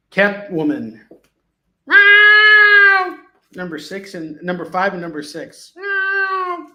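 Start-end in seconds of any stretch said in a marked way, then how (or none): 1.9-3.2 loud high shouted meow; cat meow. Pretend. Cat noise after Catwoman. 5.7-6.7 loud high shouted meow; cat meow. Pretend. Cat noise after Catwoman.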